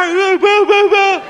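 high, loud, shrill-free mock stammer of refusal; mocking his partner's panic. Mocking the partner's scared refusal of the son, high and loud. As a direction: parrot his flustered no, no, no. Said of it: "您瞧您这相".

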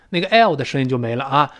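ordinary talk, nothing marked

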